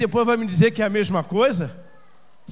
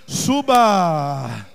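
low, long drawn-out call; deep, lingering divine command; solemn summons; 4 semitones lower, 5 dB louder than usual. Acted. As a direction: stretch the word out, sinking in pitch.

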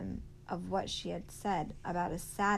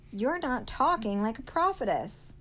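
higher, louder accusation; accusing; 5 semitones higher, 7 dB louder than usual. A narrator's reading. Sistine challenging Willie Mae, accusing. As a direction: press the charge home, raised and pointed. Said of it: "she accused".

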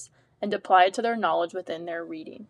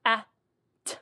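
sounding out words; faster than usual. Shown as a demonstration. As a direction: split cat into its sounds: k, a, t. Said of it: "sounding out the word cat would sound like".

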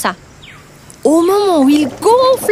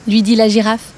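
usual speaking voice

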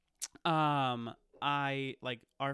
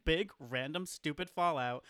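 normal talking voice

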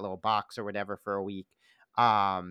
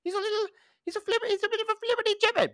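much higher, fast, prim British accent; faster than usual. Shown as a demonstration. Podcaster imitating Hugh Laurie's own voice, high prim British accent. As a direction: play up a fussy, prim British accent, quick. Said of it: "he talks like this".

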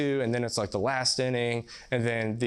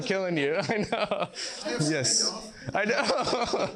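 laughing; 8 semitones higher, slower than usual. Real, not acted. Joking about killing the audience.

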